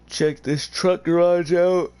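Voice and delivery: talking through a big, loud yawn. Real, not acted. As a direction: stretch the words out high as a real yawn takes over. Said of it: "Sorry about the yawning".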